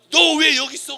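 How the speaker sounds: loud, high acted reproach; indignant shout, imitated; parental scolding. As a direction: imitate a parent pouncing on a lost child. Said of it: "예수의 부모가 와서".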